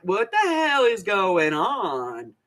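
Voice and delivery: slow, drawn-out mock bewilderment; stunned disbelief. Friend stuck in the car watching a fight, stunned disbelief. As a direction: drag each word out as if appalled. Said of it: "with this look on her face like".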